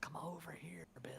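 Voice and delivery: eerie, breathy whispered coaxing; hushed, beckoning whisper; whispering alligators. Retold or imitated. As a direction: whisper it like alligators luring their prey. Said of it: "hear the alligators whispering".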